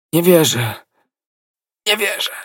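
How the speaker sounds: high, strained voice fading away; dying mumble. A narrator's reading. Soldier refuses to believe as he dies, babbling feebly. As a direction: babble it weakly with the last breath. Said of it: "wybełkotał żołnierz i skonał".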